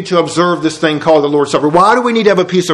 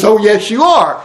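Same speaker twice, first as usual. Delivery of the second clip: insistent God; 3 semitones higher than usual. Retold or imitated. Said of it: "It says".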